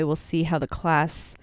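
normal talking voice

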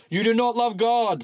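high, loud preacher accusation; sudden accusation. Acted. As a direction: accuse him without warning. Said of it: "said Bunyan suddenly".

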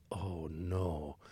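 muttered low "oh no"; quiet sinking dread, under breath. Retold or imitated. Sees the manager approaching, dread.

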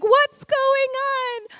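high, loud and slow caring question; gentle concern. Retold or imitated. Husband asking crying wife, slow concerned. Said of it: "he was like".

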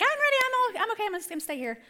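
mock high daughter voice, reluctant; lightly mimicking a hesitant daughter; daughter's voice. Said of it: "'Cause she's like".

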